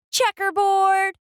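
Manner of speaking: shouted. A narrator's reading. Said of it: "Someone else shouted".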